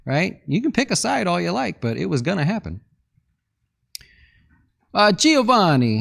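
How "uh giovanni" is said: high, loud, drawn-out Italian "Giovanni"; playful Italian accent, loud and stretched; mock Italian accent. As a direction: call out the name like a hammed-up Italian. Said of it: "How do you know that was Italian".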